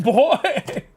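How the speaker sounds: ordinary talk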